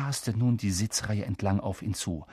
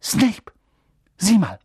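urgent; 7 semitones higher, 5 dB louder, slower than usual. Acted. Urgent alert from Hermine.

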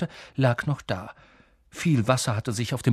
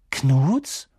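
louder, steeply rising question; puzzled question; 5 dB louder than usual. Acted. Boy asking about unknown coins, puzzled. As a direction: ask it baffled.

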